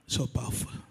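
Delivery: soft whispered aside; awed whisper. Real, not acted. Pastor marvelling at the verse to himself, whispered. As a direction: breathe it out in awe.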